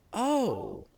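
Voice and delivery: the two brothers react to dad's news, stunned. Retold or imitated. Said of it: "do this thing simultaneously".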